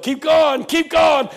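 high, loud holler; yelling. Retold or imitated. Somebody in church to the pastor, yelling. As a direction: imitate a worshipper spurring the preacher on. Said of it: "Somebody yelled".

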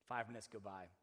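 ordinary talk, nothing marked

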